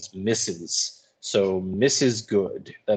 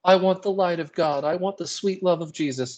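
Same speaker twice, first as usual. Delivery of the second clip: rapt and much higher; enraptured; 8 semitones higher than usual. A narrator's reading. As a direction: reach upward as if bathed in light. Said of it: "She is enraptured".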